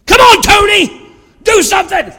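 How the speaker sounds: high, loud yell at the TV; frustrated yelling. Acted. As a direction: yell like an exasperated fan. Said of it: "yelling at the T V".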